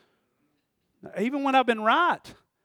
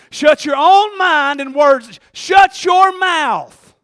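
loud, slow preacher's command; full-force preaching; 6 semitones higher, 14 dB louder, much slower than usual. Real, not acted. Preacher telling congregation to shut their mouths, full-force preaching. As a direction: stretch each word and build louder and higher.

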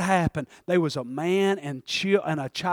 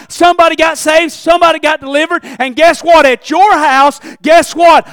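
loud, climbing sermon cry of frustration; fiery preaching; 8 semitones higher, 15 dB louder than usual. Acted. Preacher crying out to the church about an unsaved son. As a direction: preach it hard, voice straining high.

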